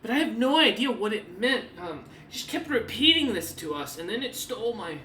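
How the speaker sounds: a hint of distress; NPC woman. Woman explaining to the party, lightly distressed. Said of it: "she goes".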